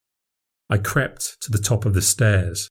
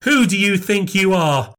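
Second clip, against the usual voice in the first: higher, outraged scolding of a grown son; furious mother; 7 semitones higher than usual. Acted. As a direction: put him in his place, pitch up. Nan furious at her son.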